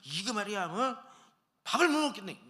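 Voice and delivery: mock a cranky elder blowing up at the table; grumpy old man. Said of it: "역정을 막 내밀고". (식당에서 역정 내는 어르신 흉내, 점점 높고 크게)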